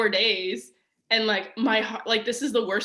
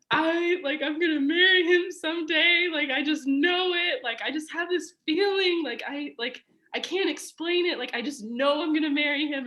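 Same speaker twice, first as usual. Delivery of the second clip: high, sing-song tearful insistence; tearful certainty; 7 semitones higher than usual. Retold or imitated. To mom and grandma in the church, high and tearful. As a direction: swear it through tears. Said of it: "cried so hard".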